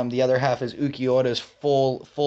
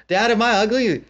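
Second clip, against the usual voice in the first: put-on high, slightly louder little girl; a small daughter asking her dad; 8 semitones higher, 4 dB louder than usual. Bakugo's daughter asks.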